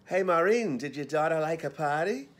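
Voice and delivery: put-on thick Boston accent, loud and high. Dresser calling to Maureen in thick Boston accent. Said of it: "the accents were as thick as clam chowder".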